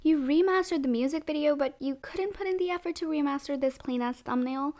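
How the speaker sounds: normal talking voice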